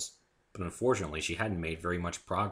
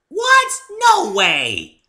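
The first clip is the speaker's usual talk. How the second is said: very high, loud indignant squeal; outraged pout; pouting Pinky; 17 dB louder, much slower than usual. A narrator's reading. Pinky protests she needs no diet, very high and loud.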